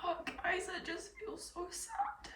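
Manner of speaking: choked up, barely voiced; snotty crying. Real, not acted. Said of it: "ugly crying".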